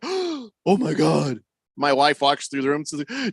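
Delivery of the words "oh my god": slow, gasping "oh my God"; shocked gasp. Retold or imitated. Announcers shocked.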